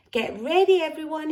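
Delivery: high, loud call to the ducks; shouted. A narrator's reading. Gumboot calling to the ducks before his last throw, a high, loud shout. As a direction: shout it out with glee. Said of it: "he shouted".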